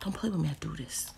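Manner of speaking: soft unvoiced whisper; whispered aside to viewers. Real, not acted. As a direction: whisper it like a secret. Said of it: "Why you whispered?".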